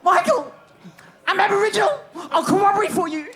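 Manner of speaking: high, loud shout at the top of the voice; screamed out. Retold or imitated. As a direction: yell it across the crowd to your idol. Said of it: "I scream out top note".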